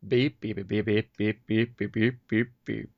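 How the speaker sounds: imitated radio pips; time pips beeps. Shown as a demonstration. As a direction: beep beep like time signal pips. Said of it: "here's some pips".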